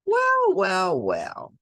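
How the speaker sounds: usual speaking voice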